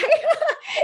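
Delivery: a high tearful laugh breaking through. Real, not acted. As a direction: laugh through rising tears. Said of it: "I'm gonna cry".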